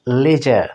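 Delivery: লেজার with an e sound, LE-zhar; লেজার, British style; British pronunciation. Shown as a demonstration. Teacher modelling British লেজার. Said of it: "ব্রিটিশে কি উচ্চারণ হয়?".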